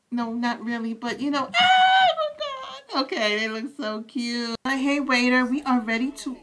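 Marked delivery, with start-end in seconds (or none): 1.5-3.0 much higher, slow, drawn-out squeal; delighted squeal. Real, not acted. Streamer gushing at the cute couple, high squeal. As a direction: melt over how cute they are.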